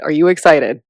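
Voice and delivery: ordinary talk, nothing marked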